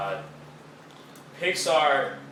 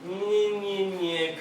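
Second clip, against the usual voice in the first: high whiny nyeh sounds; bored mocking whine; dismissive groan; 8 semitones higher, much slower than usual. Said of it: "I was just like".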